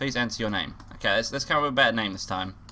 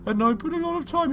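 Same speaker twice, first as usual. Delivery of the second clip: put-on character voice; 12 semitones higher, 4 dB louder than usual. Telling about the tree decorating, high put-on voice. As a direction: pitch it way up for a cartoonish character.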